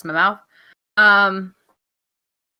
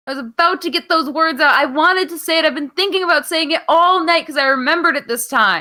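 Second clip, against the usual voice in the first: louder, higher, exasperated defence; indignant protest; 3 semitones higher, 5 dB louder than usual. Real, not acted. Host protesting co-host jumped in to say it, indignant. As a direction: insist you were getting to it.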